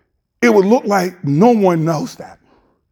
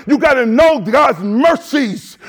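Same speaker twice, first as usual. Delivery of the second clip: ordinary delivery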